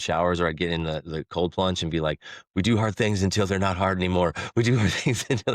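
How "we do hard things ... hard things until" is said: imitated shivering. Man psyching himself up in a cold plunge, shivering. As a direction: act out chanting through the cold.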